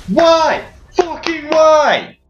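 high, slow, anguished protest; exasperated shout. Real, not acted. Gamer shouting at an ally who ran toward him.

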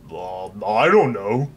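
a hint of a slow, kindly executioner; lightly voiced mild, friendly headsman; a pleasant executioner reluctant to chop; gentle executioner.

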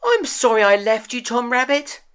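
put-on a little boy rushing to say sorry; Sammy apologizing. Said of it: "said Sammy".

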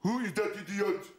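badly faked Austrian accent; Arnold Schwarzenegger. Governor mocking London's mayor, clumsy Austrian accent. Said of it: "Excuse me for the Arnie accent".

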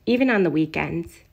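ordinary delivery, nothing marked

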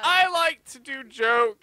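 high, slow, silly put-on voice; goofy high voice. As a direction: stretch it out in a goofy falsetto. Joking in a put-on voice.

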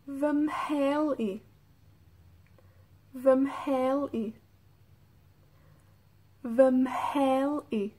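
"Vemheni" said slowly with a breathy mh; slow model for repeating. Shown as a demonstration. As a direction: stretch out each syllable for learners to copy. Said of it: "Escuchen cómo se, cómo suena". (lento y claro para que repitan: Vemheni)

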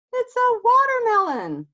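high, loud exasperated shout. Acted. Hare telling Bear it's a watermelon, exasperated. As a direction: spell it out in frustration.

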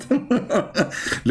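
short high cackle; amused chuckle. Real, not acted. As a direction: laugh at a ridiculous answer. Laughs at the percentage answer.

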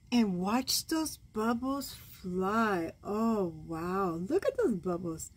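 ordinary delivery

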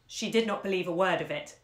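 ordinary delivery, nothing marked

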